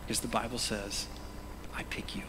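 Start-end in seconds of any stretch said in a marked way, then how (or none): 1.7-2.2 whispered. Acted. Voicing God's words.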